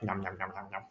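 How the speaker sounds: very soft, low nom-noms; mock nibbling under the voice; munching noises. Imagining Yuyuko snacking on the food, quiet munching. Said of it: "she's probably just like".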